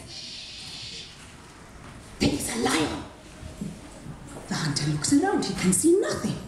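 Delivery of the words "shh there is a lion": hushed whispered warning. Acted. Blind man warns the hunter of a nearby lion, whispering. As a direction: breathe it out, barely voiced, don't scare the lion.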